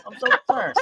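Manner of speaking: high, mocking fake concern. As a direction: squeak it like fake sympathy. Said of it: "Like, oh".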